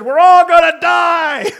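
high, loud, slow scream; child's panicked yell. Retold or imitated. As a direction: scream it through the house like a scared child. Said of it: "Abby got up from her bed, said".

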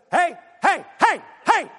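high, loud, sharp repeated calls; fired-up calling out. Acted. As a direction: call out to someone ignoring you. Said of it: "Get a little fired up".

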